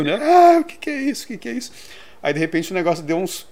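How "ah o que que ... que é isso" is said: imitating a panicked crowd, pitch shooting way up; mock hysterical panic. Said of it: "E o pessoal histérico".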